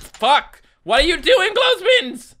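shrill outraged shout at clothespins; high exasperated yell. Real, not acted. Yelling at falling clothespins.

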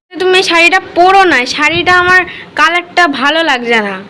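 loud and slow, arguing. Real, not acted. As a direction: raise your voice over the saree. Family quarrel over a saree.

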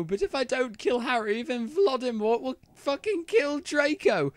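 high-pitched, upset rant; tearful outburst. A narrator's reading. Ebony telling Bloody Mary of Voldemort's threat, distraught. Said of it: "I burst into tears".

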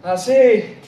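usual speaking voice

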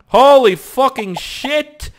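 high, drawn-out yell; shocked shout. Real, not acted. Gamer to screen in sudden danger, shocked shout. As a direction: bellow each word slowly in shock.

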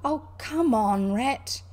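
exasperated call to hurry up; impatient. A narrator's reading. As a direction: drag out the words in impatience. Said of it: "the Mole waited impatiently".

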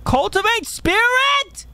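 slow, high, incredulous question; stunned disbelief. Real, not acted. Stunned reading an upgrade option.

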